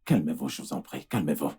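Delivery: low, hushed plea; soothing. Acted. Doctor calming the angry husband, soothing. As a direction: softly try to settle an angry man.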